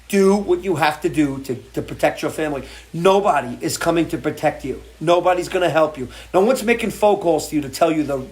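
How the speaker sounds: normal talking voice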